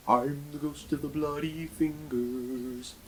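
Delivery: slow, eerie ghostly moan; spooky ghost voice. Acted. Ghost calling from the bathroom to the cyclist, eerie moan. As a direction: draw it out like a haunting.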